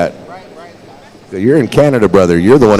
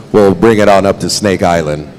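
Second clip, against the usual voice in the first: normal talking voice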